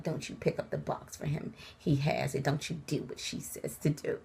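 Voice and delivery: low, soft, hissed warning. Retold or imitated. Father-in-law warning his son off the box, hissed low. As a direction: whisper the order through your teeth.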